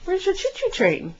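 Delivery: playful high question. Real, not acted. Asking toddler, higher playful take.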